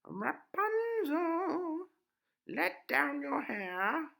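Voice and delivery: very high, loud, slow witch's call; a witch singing up the tower, high and drawn out; high, slow, loud crone voice. Acted. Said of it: "As the witch climbed up".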